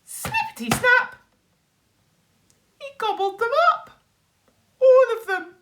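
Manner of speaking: very high, loud and quick; spring the twist with glee; gleeful snap. A narrator's reading. The storyteller reveals the wolf ate them, very high and loud.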